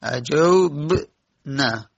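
"اجوبن" stretched very slowly, each sound held; slow, careful "اجوبن"; drawn-out spelling. Shown as a demonstration. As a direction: draw out "اجوبن" as if spelling it for students.

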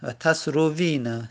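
ordinary talk

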